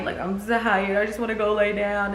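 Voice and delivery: a touch of weary whining; mild tired whine. Retold or imitated. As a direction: say it like you've given up. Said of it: "I'm just like".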